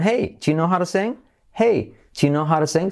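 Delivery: "Hey, do you know how to sing?" starting high on "Hey" with a rising question tune; intonation. Shown as a demonstration. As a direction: lift "Hey" and let the question ring upward. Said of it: "Entonación".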